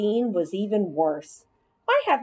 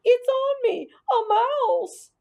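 much higher, louder panicky bully; panicked scream; 4 dB louder, slower than usual. Acted. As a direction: shriek in terror at the mouse. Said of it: "threw his hands up in the air and screamed".

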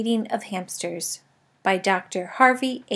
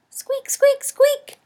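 very high, thin squeaks; hamster squeak; much slower than usual. A narrator's reading. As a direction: squeak eagerly like a small hamster. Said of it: "I tried to tell him".

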